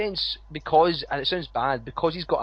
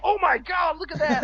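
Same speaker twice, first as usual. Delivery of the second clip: much higher, hammed-up commentator gasp; mocking Jerry Lawler's squeal; mock excited commentator; 13 semitones higher than usual. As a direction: send up a commentator losing it over a spot. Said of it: "he's like".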